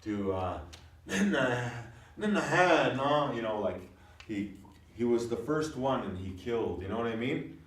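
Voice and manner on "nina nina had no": higher, louder Grovan phrase; emphatic Grovan. Shown as a demonstration. Teacher showing the changed form, emphatic. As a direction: stress it up. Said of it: "you could change this".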